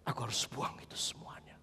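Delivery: soft unvoiced whisper, low; hushed whisper of resolve. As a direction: breathe the resolve like a secret.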